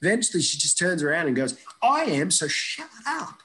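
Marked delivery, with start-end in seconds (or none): 1.8-3.4 higher, slower annoyed retort; annoyed snap. Retold or imitated. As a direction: cut him off, fed up. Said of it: "she gets annoyed".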